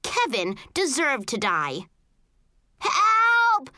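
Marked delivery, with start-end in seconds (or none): 2.8-3.7 put-on high, loud kid's scream; screaming for help. A narrator's reading. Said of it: "Kevin screamed".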